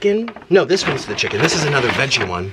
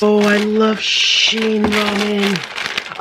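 gushing; 6 semitones higher, much slower than usual. Real, not acted. Gushing over gift ramen.

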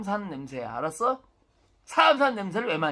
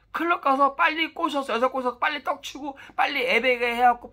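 urging advice; 6 semitones higher than usual. Retold or imitated. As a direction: shout the advice at the young crowd like a rowdy uncle. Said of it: "젊은 친구한테 항상 얘기하는 게 뭐냐?".

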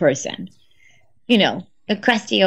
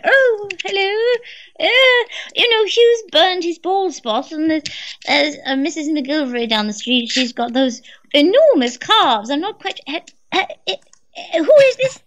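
put-on higher, fluttery old mother answering the phone; elderly mum voice; 7 semitones higher than usual. [name] imitating her mum answering the phone, higher and confused.

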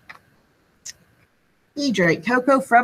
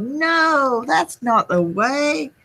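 put-on children yelling; 6 semitones higher, slower than usual. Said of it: "The children yelled".

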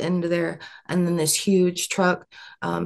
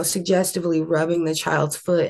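usual speaking voice

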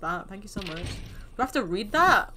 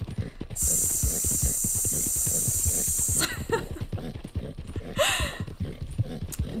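long, steady snake hiss; drawn-out unvoiced "sss"; snake impression hiss; 5 dB quieter than usual. Shown as a demonstration. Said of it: "this is my snake impression".

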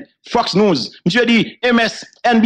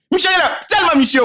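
exclamation forte; 8 semitones higher than usual. Retold or imitated. Excited retelling.

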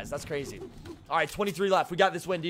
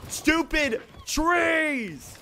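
long, drawn-out annoyed shout, higher; frustrated yell; 8 semitones higher, much slower than usual. Real, not acted. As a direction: stretch it out, fed up with the trees. Stuck on trees in game.

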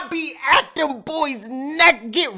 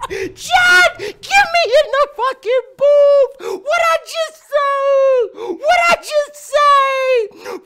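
very high, loud, laughing screams; ecstatic yelling; 5 dB louder, slower than usual. Real, not acted. Streamer screaming to Chad to get him in the booth, ecstatic. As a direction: shriek it out, overjoyed. Hyped, calling friend to booth.

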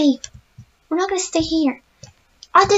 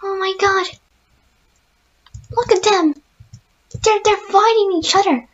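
usual speaking voice

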